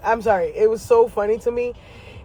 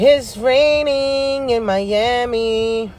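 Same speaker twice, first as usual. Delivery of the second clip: slow drawn-out sing-song; 3 semitones higher, much slower than usual. Shown as a demonstration. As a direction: stretch every word out slowly, half singing. Repeats line, second take drawn out.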